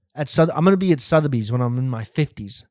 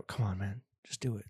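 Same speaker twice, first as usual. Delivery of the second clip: soft coaxing; 5 semitones lower, 14 dB quieter than usual. Pretend. Coaxing a guest.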